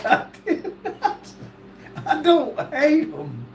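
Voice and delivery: breathless high laugh through the words; laughing denial. Real, not acted. Protesting to the chat while laughing. Denies hating Yankees, laughing.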